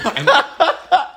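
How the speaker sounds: sudden high-pitched laugh; high burst of laughter. Real, not acted. Laughing at own claim.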